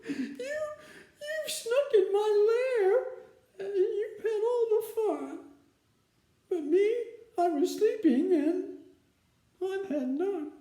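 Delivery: high, whiny, hurt complaint; whimpering bear.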